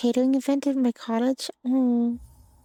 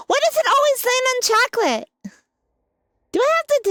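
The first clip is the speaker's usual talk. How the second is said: shocked squeal; 10 semitones higher, 5 dB louder than usual. Real, not acted. Wheel lands again.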